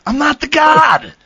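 coach yelling. Retold or imitated. Football coach yelling at camp, hammed-up shout. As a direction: bellow it like a football coach with a thick accent. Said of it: "I was getting yelled at".

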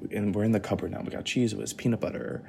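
normal talking voice

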